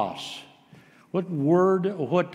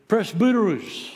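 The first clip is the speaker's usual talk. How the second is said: high exclaimed Greek word; exclaiming; 6 semitones higher, 3 dB louder than usual. Shown as a demonstration. Preacher voicing Peter's opening word in Greek, exclaimed. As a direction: come right out with it. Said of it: "just comes right out and just exclaims".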